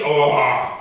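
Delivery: low, lightly voiced cartoon devil grunt; a hint of a horned devil saying oh to scare; mock devil groan. Preacher imitating the devil with horns and tail, low mock growl. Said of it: "y la cola y el tridente y viene y te dice".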